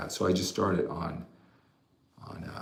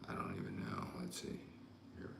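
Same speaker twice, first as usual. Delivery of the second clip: very quiet, low aside; muttered to himself; 12 semitones lower, 21 dB quieter, faster than usual. Real, not acted. Host checking his follower count, muttering to himself. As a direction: murmur it under your breath.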